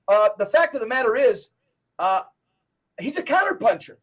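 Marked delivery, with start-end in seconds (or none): none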